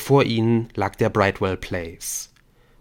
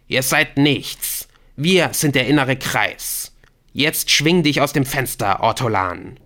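contemptuous raised-voice captor; scornful command; 4 semitones higher, 4 dB louder than usual. Acted. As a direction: belittle them, then order him out. Alien scorns and orders.

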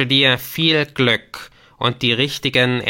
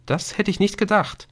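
plain reading voice

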